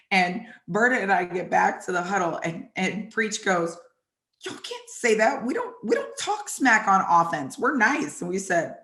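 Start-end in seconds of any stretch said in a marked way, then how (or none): 4.5-8.2 higher-pitched, protesting reprimand; mock scolding. Preacher quarterback to trash-talking players in the huddle, mock scolding. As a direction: scold them in a raised, indignant retold voice.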